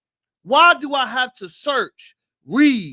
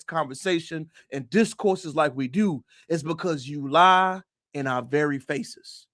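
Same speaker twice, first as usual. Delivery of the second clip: normal talking voice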